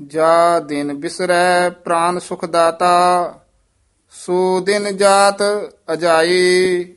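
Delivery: measured chant with held line endings; scripture recitation. Acted. Teacher models Gurbani for students, measured chanted recitation. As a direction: recite evenly, pausing between phrases.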